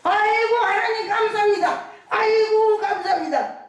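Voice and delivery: put-on an old grandmother overwhelmed with gratitude; grateful old woman. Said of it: "그랬더니".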